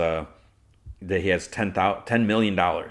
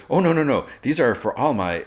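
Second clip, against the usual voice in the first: higher, flustered mock protest; imitated nervousness; 6 semitones higher, 4 dB louder than usual. Jimmy fending off Lois's teasing about watches, mock nervous. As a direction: act out a nervous, hurried denial. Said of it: "laughs nervously".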